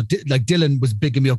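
ordinary talk, nothing marked

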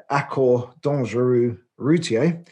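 Accord Dangereux Routier in a rough English-speaker's French accent; clumsy French accent on Accord Dangereux Routier. Shown as a demonstration. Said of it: "forgive my appalling French accent".

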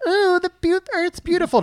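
very high, airy mock-wonder; put-on high-pitched sightseer gushing; mock cooing admiration. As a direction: coo in a squeaky high voice. Mocking plane passengers admiring view.

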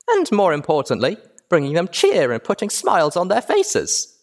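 put-on very high, loud, giddy child voice; squeaky, bubbly filly; excitable filly tour guide. A narrator's reading. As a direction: play a hyper little girl hosting a tour.

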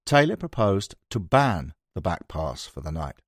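usual speaking voice

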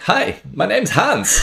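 high, slow mock greeting; drawn-out high-pitched hello; German instructor. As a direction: introduce yourself as a comic German. Quotes the German instructor introducing himself.

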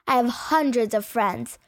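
ordinary delivery

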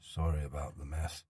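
put-on low, soft voice of a gentle old man; a weary old man coaxing a stranger softly; quiet old man. Old man character speaks.

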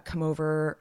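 ordinary delivery

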